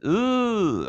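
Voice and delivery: high, soft "Ooh" like a caught Lucy; a light, quiet squeal of "Ooh"; Lucy's trouble sound. Retold or imitated. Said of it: "it's Lucy when she's in trouble".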